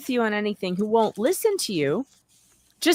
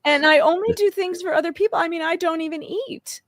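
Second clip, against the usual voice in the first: mock-tearful martyr voice, rising in pitch and sagging; exaggerated crying impression; [name]'s signature crying; 3 semitones higher than usual. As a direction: put on a hammed-up weepy self-pity, faltering between pleas. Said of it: "[name] does her signature crying".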